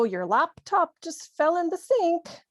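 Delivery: spouse's bad news. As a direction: break bad news as a lightly voiced spouse. Spouse voiced breaking bad news.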